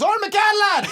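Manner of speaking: very high, loud imitated shout; fan shouting name. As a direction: bellow his name out the window. Fan yells from car again.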